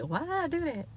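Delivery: high, soft mock regret; regretful. Vlogger acting out regret over shrinkage, high soft whine. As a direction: whine about the haircut.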